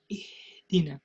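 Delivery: ihdina whispered, ha lost in air; breathy whisper of ihdina; wrong whispered ha. Shown as a demonstration. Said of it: "We don't say".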